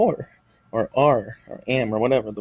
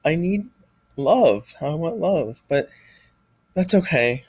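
struggling to speak through real crying, much higher; crying right now; 5 semitones higher than usual. Real, not acted. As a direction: voice breaking into high pitched sobbing words, real tears. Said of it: "like I'm right now".

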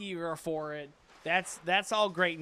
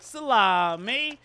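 slow, drawn-out mock Italian "Salami"; mock Italian accent; 2 semitones higher, 5 dB louder, much slower than usual. Shown as a demonstration. Player mocks Italians while ordering, Italian accent. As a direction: stretch "Salami" slowly with a mocking Italian lilt. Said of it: "like you're making fun of Italian people".

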